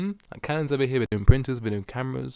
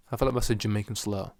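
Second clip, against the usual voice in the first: ordinary talk, nothing marked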